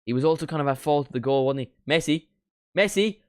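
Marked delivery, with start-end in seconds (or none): 1.9-3.2 loud, high shouts, climbing on the repeat; excited name call. Real, not acted. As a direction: call out the shooter with rising hope. Live call of a shot.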